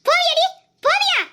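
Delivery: furious father shouting at his daughter; angry yell. Acted. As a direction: yell at her to get moving. Father yelling at child while beating.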